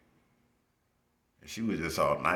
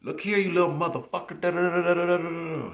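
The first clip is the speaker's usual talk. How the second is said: much higher, louder mock cussing out; mock furious teacher; 12 semitones higher, 5 dB louder than usual. Teacher cussing out a student who pissed her off, high furious mock. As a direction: explode like a tiny furious lady. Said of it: "you piss her off".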